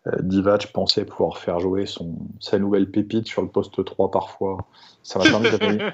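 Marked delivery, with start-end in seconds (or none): 5.0-5.9 sarcastic. Real, not acted. Sarcastic jab at Divac.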